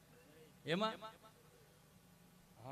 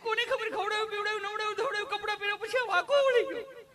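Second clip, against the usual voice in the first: much higher, much louder hammed-up panic screams; panicked shrieking mother; 14 semitones higher, 6 dB louder, faster than usual. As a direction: shriek for help like the child is dying. (ગભરાટમાં ખૂબ ઊંચી, મોટી રાડો)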